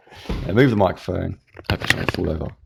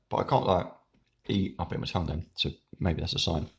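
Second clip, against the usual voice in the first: ordinary talk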